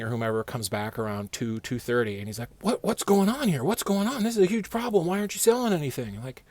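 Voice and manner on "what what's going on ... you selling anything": much higher, alarmed questions; hammed-up alarmed boss; panicked boss. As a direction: imitate the boss freaking out.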